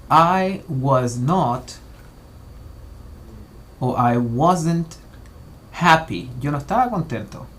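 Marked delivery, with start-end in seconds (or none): 0.1-6.3 very slow, each word set apart for learners; slow and clear for students; slow model sentence. Shown as a demonstration. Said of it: "Veámoslo de la siguiente forma".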